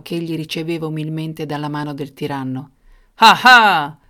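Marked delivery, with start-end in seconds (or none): 3.1-4.0 loud mock laugh, roared out; big, booming tyrant's guffaw. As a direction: roar a tyrant's "ha ha". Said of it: "ruggì quest'ultimo".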